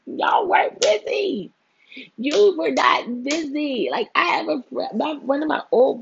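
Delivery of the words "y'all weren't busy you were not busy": much higher, louder, slow accusing; exasperated callout. Retold or imitated. Vlogger calling out friends who claimed to be busy. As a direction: stretch each word out in disbelief. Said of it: "I'm like".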